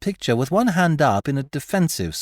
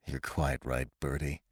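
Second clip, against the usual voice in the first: much lower and softer, defeated; groaning; 11 semitones lower, 11 dB quieter than usual. A narrator's reading. Corky admits to Bertie the portrait failed, groaning. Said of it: "He groaned".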